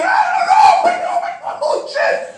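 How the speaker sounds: very high, frantic shouting; overwhelmed, shrieking babble; panicked squeal. Acted. His panic as players charge.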